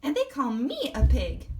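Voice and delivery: a squeaky little guinea pig, indignant. Acted. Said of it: "a squeaky voice".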